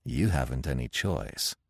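low, calm certainty; quiet, low, final word; assured. A narrator's reading. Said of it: "he assured her".

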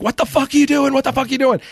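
high-pitched imitation of alarmed workers; alarmed road crew. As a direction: demand it high, as the men running up. Acts out road crew running at him.

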